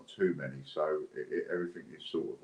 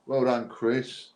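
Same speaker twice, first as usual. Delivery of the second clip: excited congratulations; 8 semitones higher, 6 dB louder, much slower than usual. Real, not acted. Congratulating the winner live.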